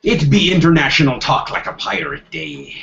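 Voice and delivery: gruff pirate talk. Pretend. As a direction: speak like a pirate.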